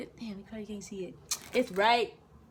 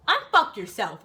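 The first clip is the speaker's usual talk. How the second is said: loud, punched command; sudden loud order; drill sergeant; 10 dB louder, slower than usual. Retold or imitated. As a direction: imitate a sergeant dressing down a private. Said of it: "My drill sergeant said".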